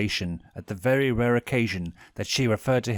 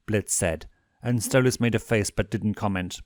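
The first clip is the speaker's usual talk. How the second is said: usual speaking voice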